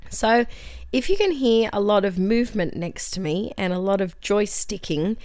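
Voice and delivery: normal talking voice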